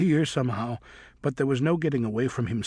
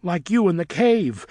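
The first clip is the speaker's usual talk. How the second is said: higher, louder retort; shouting back; 6 semitones higher, 6 dB louder than usual. A narrator's reading. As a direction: fire it straight back at her. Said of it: "Griffin shouted back".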